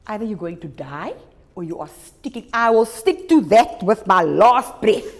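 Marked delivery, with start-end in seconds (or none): 2.5-5.1 booming, slow and solemn; loud slow vow. Acted. Teacher voicing a vow to stick it out, loud and slow. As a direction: declare it like an oath, loud and slow.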